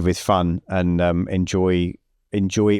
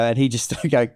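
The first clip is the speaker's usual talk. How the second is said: ordinary talk, nothing marked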